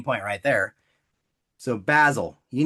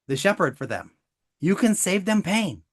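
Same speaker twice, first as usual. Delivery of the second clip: normal talking voice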